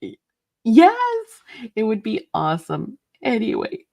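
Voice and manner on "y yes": usual speaking voice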